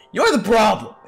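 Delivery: shouted accusation. Acted. Inner voice turns blame on the character, shouting. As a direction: throw the blame back with a shout.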